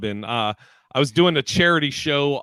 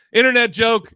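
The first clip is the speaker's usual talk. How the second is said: higher, louder deadpan heckle; heckler jab; 8 semitones higher, 6 dB louder than usual. Retold or imitated. Heckler at the back calls out a higher, louder jab. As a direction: toss the jab from the back of the room. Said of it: "He goes".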